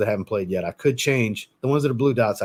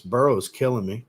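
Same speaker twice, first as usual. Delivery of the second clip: usual speaking voice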